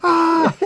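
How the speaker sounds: high, loud yelp of fright; mock startled scream. Teacher screaming at the cop's gun, mock fright. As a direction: scream as if caught at gunpoint.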